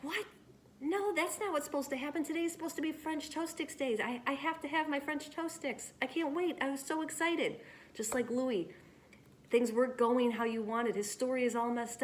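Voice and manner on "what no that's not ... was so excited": put-on higher, whiny protesting child; disappointed kid. A student protests the changed lunch menu, whiny and upset. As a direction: whine like a kid losing a treat. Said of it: "you're feeling like".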